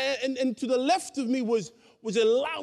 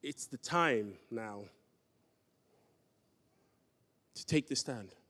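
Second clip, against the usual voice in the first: quiet solemn reply; 11 semitones lower, 9 dB quieter than usual. Retold or imitated. God telling a reluctant believer to testify, quiet and solemn. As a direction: voice God's answer quietly and gravely. Acts God's solemn answer to him.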